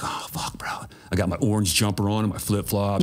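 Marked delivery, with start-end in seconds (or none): 0.0-0.7 hushed voiceless "oh, fuck, bro"; whispered dismay. Retold or imitated. New inmate seeing a hostile cellmate, whispered dismay. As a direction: breathe it out, caught off guard. Guy in cell messing with him.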